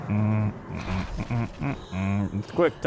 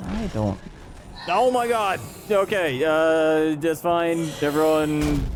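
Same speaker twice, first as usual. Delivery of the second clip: high, loud startled panic; real jump scare shriek; panicking gamer; 7 semitones higher, 4 dB louder, much slower than usual. Real, not acted. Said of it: "calming down after a brief moment of gaming anxiety".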